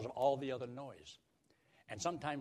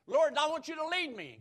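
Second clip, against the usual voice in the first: high, loud cry to the Lord; hammed-up prayerful plea; loud acted plea; 8 dB louder than usual. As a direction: call up to God, pitched high. Acts out a believer's plea to God.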